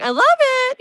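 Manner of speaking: high, loud and thrilled; real delight. Real, not acted. Tarot reader to Lisa, loving the card she drew: excited. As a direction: gush over the card.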